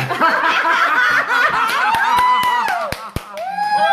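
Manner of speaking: long, high, loud cackle of laughter. Real, not acted. Retelling her confrontation, breaking into loud laughter. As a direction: howl with laughter.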